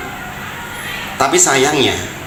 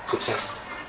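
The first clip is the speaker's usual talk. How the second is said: breathy unvoiced whisper, much softer; bitter whisper; 4 semitones lower, 9 dB quieter than usual. Preacher mocks the rich son's success, whispered. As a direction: drop to a bitter hush.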